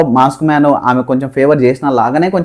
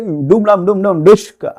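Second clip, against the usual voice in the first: higher, playful imitation of a movie fight beat; mock fight noises; 6 semitones higher than usual. Vlogger mimics Temper movie fight, hammed-up sound effects. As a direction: sound out the action like a film punch scene. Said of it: "అసలు temper లే".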